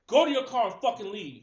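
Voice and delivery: loud, hard command; angry cop order. Retold or imitated. Officer ordering the teen away, loud and angry. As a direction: spit it out like a furious cop.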